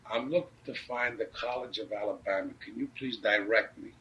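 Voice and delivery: measured, proper full-sentence request; proper polite request. Retold or imitated. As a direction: ask politely, as etiquette demands. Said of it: "that's the proper way to, to ask".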